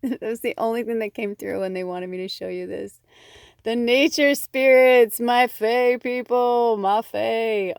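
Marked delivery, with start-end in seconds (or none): 3.6-7.7 loud slow calling out to the fae; excited calling. Acted. Greets the nature spirits on the card, loud and excited. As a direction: joyfully call your fairy folk, loud and drawn out.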